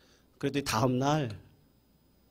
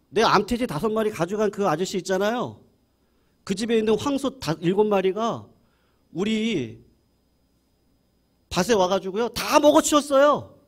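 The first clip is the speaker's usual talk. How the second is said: gleeful report; 7 semitones higher, 4 dB louder than usual. Retold or imitated. Farmer gleefully reporting neighbor's loss.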